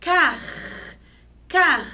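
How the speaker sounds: "Car" with a long throaty friction R; strong final R. Shown as a demonstration. Teacher showing R in first syllable, strong R. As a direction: hold the rasped R closing CAR. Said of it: "o som fica junto com a primeira sílaba".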